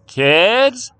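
high, loud call to another room. A narrator's reading. Mother on the stairs to her kids, calling out. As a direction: call up sharply for the children.

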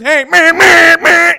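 loud high honking noises, meh meh; car horn honks. Retold or imitated. Mimicking the horns in a radio ad, loud high honks.

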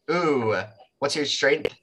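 ordinary talk, nothing marked

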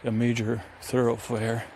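ordinary talk

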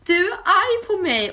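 high, loud, slow mock sob; mock crying. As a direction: imitate the friend crying. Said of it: "börjar gråta så här". (härmar gråtande väninna)

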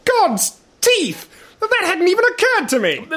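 high-pitched shocked exclamation; dismayed cry, pitch jumping up; appalled outburst. Acted. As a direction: blurt out a horrified realisation. Shocked realisation about his scar.

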